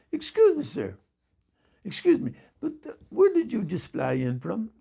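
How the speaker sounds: imitated East Indian cabbie, starting high; polite question in a put-on East Indian accent. Cab driver asks his passenger, in an East Indian accent. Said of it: "I'm gonna attempt to do his accent".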